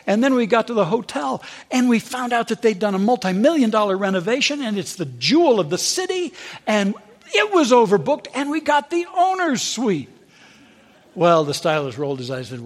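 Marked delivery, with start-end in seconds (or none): 0.1-10.1 high, gushing traveller; excited. Retold or imitated. Woman gushing to stylist about her trip, high and excited. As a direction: bubble over about the trip. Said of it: "she starts getting all excited".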